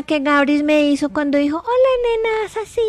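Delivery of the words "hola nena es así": mock tender greeting. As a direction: tease his tender hello. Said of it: "la cara tierna".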